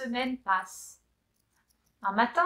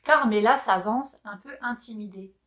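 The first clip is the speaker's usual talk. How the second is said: usual speaking voice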